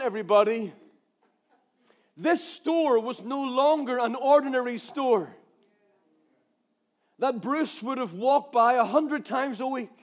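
normal talking voice

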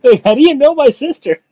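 high-pitched, half-laughing mock panic; mock bewildered protest. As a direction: act out a baffled victim's high-pitched protest. Retold victim tied up by Inquisition.

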